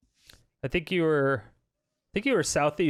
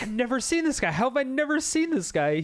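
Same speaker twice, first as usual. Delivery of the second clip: high-pitched retold disbelief; incredulous; 11 semitones higher than usual. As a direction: recount your amazement in a raised pitch. Retells own amazed reaction to streamer.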